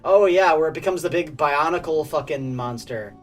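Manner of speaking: ordinary talk, nothing marked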